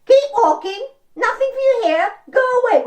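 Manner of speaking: high, loud squawking bird voice; crane squawks. Shown as a demonstration. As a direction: squawk all three warnings. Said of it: "So how did that go?".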